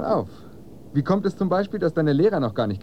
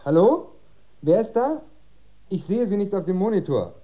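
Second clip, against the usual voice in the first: loud intercom call; 8 semitones higher, 5 dB louder than usual. Acted. Father answers the intercom.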